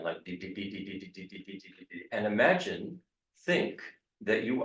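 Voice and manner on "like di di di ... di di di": "di" repeated, softer and softer; staccato. Shown as a demonstration. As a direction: fade "di, di, di" to nothing. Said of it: "we will repeat the D over and over again".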